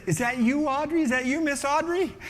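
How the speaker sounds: high, startled recognition; a boy suddenly recognising his old teacher; lightly voiced teenage boy, surprised and high. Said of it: "And Jack says".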